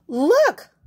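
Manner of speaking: high, loud cluck of a word; clucking hen. Acted. Hen clucking excitedly at the library. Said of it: "clucked the hen".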